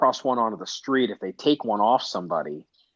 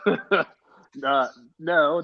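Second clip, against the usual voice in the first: ordinary talk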